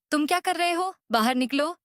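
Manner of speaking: high, sharp telling-off; high-pitched angry order to get out; scolding outburst. Retold or imitated. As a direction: throw him out, indignant. Teacher scolds laughing student.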